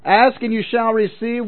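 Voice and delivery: ordinary talk, nothing marked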